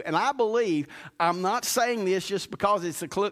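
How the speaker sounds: preaching the altar call. Acted. Pastor closing the message to the church, preaching earnestly. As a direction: press them gently toward a calling.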